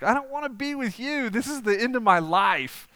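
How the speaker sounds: mock dying complaint with a laugh; panicked mock lament. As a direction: wail it at the clowns as if doomed.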